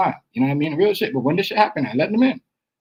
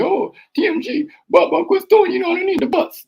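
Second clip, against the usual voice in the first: high-pitched mock pleading quote; mocking imitation. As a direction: mimic him in a much higher voice.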